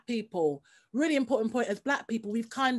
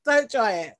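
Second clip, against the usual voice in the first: ordinary talk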